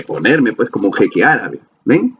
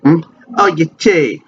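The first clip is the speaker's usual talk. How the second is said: higher, slower Argentine accent demo; 7 semitones higher, slower than usual. Shown as a demonstration. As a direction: play a young Argentine tourist with an Argentine accent. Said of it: "ahí sí puedo modular el acento". (acento argentino de chico turista)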